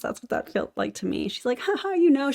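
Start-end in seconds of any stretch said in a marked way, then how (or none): 1.6-2.3 much higher mock breezy laugh-talk; light and airy. Commentator mimics the seller's fake breezy tone, light and higher. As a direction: imitate a fake-casual brush-off. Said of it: "She tried to make it, like, light and airy".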